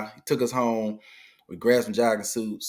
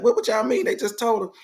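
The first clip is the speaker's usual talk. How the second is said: incredulous; 12 semitones higher, 4 dB louder than usual. Retold or imitated. Father-in-law's disbelief.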